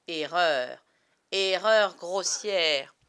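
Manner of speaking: loud, drawn-out declaration of error; booming, stretched-out correction; emphatic verdict. Acted. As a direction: pronounce it slowly and forcefully. Pronouncing the boys badly mistaken.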